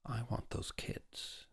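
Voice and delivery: very soft, slow, near-whispered; quiet. A narrator's reading. Father admits he wants the triplets, quietly. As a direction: say it hushed and drawn out. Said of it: "said Welling quietly".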